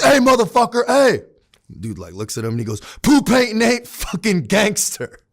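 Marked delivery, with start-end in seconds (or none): 0.0-1.2 high, loud imitation of a man yelling through glass; mock confrontational yell; retold angry shout. 3.0-5.2 higher, slow mocking jeer; drawn-out, raised-pitch put-down in another man's voice; retold taunt. Retold inmate taunt through the glass.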